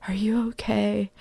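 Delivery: hushed, careful concern; quiet, tentative question; gentle dad. Retold or imitated. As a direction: softly imitate a worried father checking in. Dad gently checking on hurt child.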